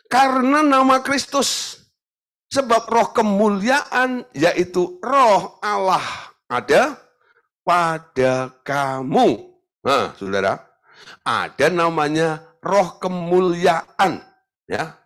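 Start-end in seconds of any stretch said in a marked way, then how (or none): 0.1-9.5 high, slow, solemn reading of the verse; a raised, measured Bible recitation; scripture reading. Acted. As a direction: proclaim the verse to the congregation. Reads scripture aloud.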